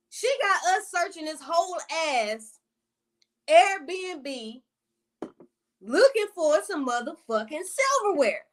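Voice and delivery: high, exasperated complaint; tripping. Retold or imitated. Storyteller griping about searching the whole Airbnb, exasperated. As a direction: draw it out in retold disbelief. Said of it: "And I'm tripping".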